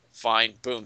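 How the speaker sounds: ordinary delivery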